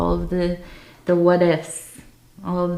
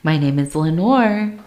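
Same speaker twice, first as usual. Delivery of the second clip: normal talking voice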